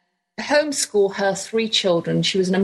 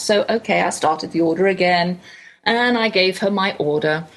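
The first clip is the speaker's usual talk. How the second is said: usual speaking voice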